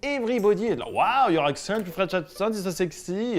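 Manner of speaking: English with a heavy French accent. Shown as a demonstration. Teacher bragging about his accent abroad, French accent. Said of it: "the people who speak like me".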